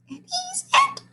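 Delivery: very high squeak. A narrator's reading. A frightened teacher squeaking about the newcomer, very high. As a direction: squeak it out in terror. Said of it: "squeaked Mister Jupiter".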